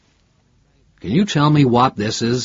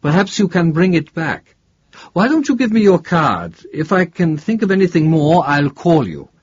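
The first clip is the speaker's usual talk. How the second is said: higher voice covering a mild letdown; concealed disappointment; 7 semitones higher, 4 dB louder than usual. A narrator's reading. Professor asking investigator for his card, hiding disappointment. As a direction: keep the disappointment under a helpful offer. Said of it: "tried to conceal his disappointment".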